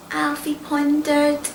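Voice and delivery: ordinary delivery, nothing marked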